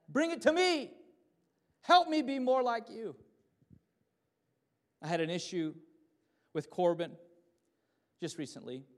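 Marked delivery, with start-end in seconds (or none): 0.1-3.1 loud, high cry to God; belted, pleading prayer; impassioned plea. Acted.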